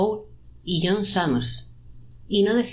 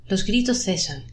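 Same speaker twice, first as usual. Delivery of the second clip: ordinary delivery, nothing marked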